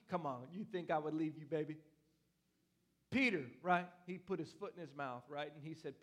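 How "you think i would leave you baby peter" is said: ordinary talk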